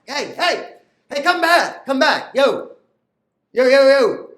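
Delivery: calling out. Acted. Calling wife back from moon.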